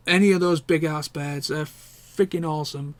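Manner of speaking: ordinary talk, nothing marked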